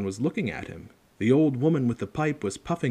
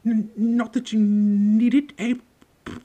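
high, jittery stutter; Professor Quirrell, nervous stammer; 13 semitones higher, 7 dB louder than usual. A narrator's reading. Teacher jokes uneasily with Potter, stammering nervously. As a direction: draw out the stuck first sounds nervously. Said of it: "He laughed nervously".

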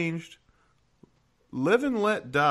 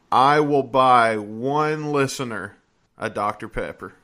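normal talking voice

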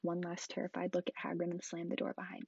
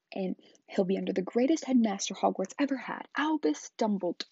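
furious shout; 4 semitones higher, 9 dB louder than usual. Acted. Hagrid shouting down Uncle Vernon, furious and loud. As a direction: bellow it, outraged for Harry's sake.

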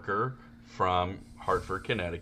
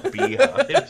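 laughing; 6 dB louder than usual. Real, not acted. Laughing while telling joke.

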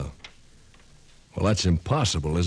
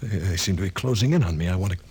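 as if the walls are crushing in; rising panic; faster than usual. Acted. Walls closing in, darkness panic.

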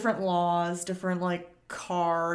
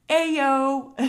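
imitating a kid's loud, high yell; yelled greeting; 7 semitones higher, 9 dB louder than usual. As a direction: holler it like a kid calling across a yard. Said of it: "yells at the kid".

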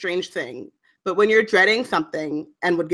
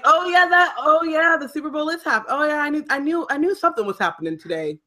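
high-pitched imitation of a bluffing friend; mock clueless friend; 8 semitones higher than usual. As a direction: pretend you knew all along, pitched up. Mimics friend feigning sports knowledge.

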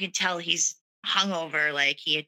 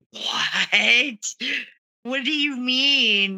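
higher and louder, slow stunned what; shocked disbelief; 6 semitones higher, 3 dB louder, much slower than usual. Retold or imitated. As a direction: cry out in disbelief at the news. Told husband cheated last night.

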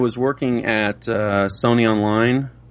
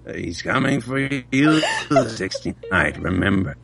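devil voice. Pretend. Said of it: "Do the devil thing".

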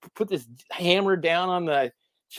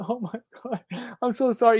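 imitated crying apology, higher pitched; retold tearful apology; 6 semitones higher than usual. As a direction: act out a distraught friend apologizing. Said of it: "he starts crying".

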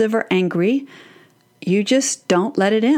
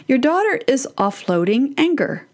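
usual speaking voice

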